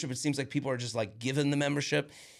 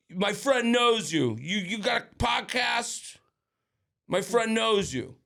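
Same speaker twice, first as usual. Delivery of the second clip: hammered guy; 7 semitones higher, slower than usual. Retold or imitated. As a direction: drag the words like a hammered barfly. Drunk stranger voiced in story.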